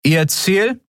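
self-important guard. A narrator's reading. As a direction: question them like you own the street.

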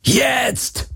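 very high, strained yell without much volume; high-pitched, drawn-out battle cry; roar. A narrator's reading. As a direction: yell the signal with all that is left. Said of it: "brüllte Garland".

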